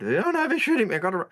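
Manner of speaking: high, loud mock panic; cowardly NPC. Mimicking a panicking NPC crewman, high mock panic. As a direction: squeal like a frightened crewman. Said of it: "the coward".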